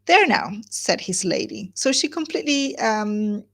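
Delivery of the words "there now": high, loud and bright "there now"; triumphant chirp. Acted. Mrs Palmer triumphantly answering her sneering husband. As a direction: crow it happily.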